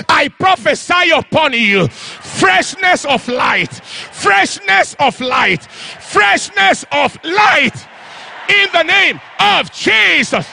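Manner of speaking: slow, drawn-out proclamation; prophetic declaration. Acted. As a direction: stretch each word as you prophesy.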